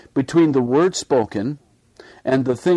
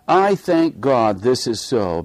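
ordinary delivery

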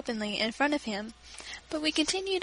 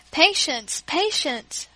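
louder, higher call for patience; raised voice to calm them; crying out; 4 semitones higher, 5 dB louder, much slower than usual. Acted. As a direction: hold the children back firmly. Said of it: "cried my father".

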